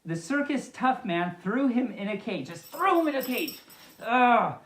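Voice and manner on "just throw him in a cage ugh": mock outrage. Real, not acted.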